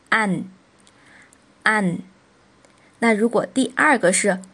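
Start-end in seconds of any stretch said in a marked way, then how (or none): 0.1-2.0 "an" said alone, closing on a clear n; front nasal ending. Shown as a demonstration. Teacher modelling the front nasal for learners, "an" ending in n. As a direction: say "an" twice, separated by a pause. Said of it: "就读成".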